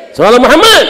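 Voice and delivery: high, loud cry of "Muhammad"; calling the congregation to send blessings, loud and high. Real, not acted. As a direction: rouse the crowd with it. Call to the congregation.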